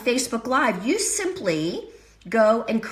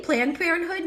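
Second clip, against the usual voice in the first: usual speaking voice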